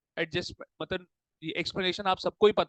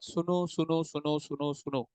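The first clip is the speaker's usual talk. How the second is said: softly repeated call to listen, fading lower; quiet hushing; 3 semitones lower, 6 dB quieter, slower than usual. Real, not acted. Teacher settling the class before explaining, quiet and fading.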